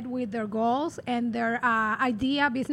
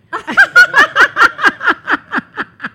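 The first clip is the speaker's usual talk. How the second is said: loud burst of laughter; 12 semitones higher, 15 dB louder than usual. Real, not acted. Joke about being a question mark.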